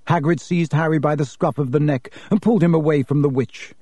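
usual speaking voice

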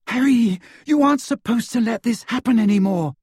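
high, loud protest; distressed cry. A narrator's reading. Hermione scolding Harry about his visions, crying out. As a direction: raise the voice in alarmed reproach. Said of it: "Hermione cried, her voice echoing".

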